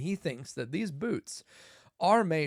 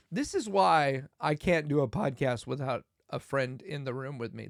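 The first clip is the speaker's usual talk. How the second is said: normal talking voice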